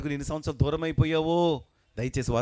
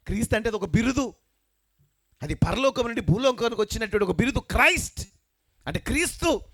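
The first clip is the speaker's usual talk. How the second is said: rising to a high, loud preaching cry; preaching build-up; 8 semitones higher, 4 dB louder than usual. Acted. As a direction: build the point up to a shout. Preacher explains title, rising force. (పెరుగుతున్న ఉత్సాహంతో ప్రసంగం)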